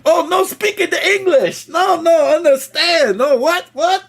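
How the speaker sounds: mock confused worker. Mocking the counter worker who won't help him. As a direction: play the baffled counter lady. Mimics worker not understanding English.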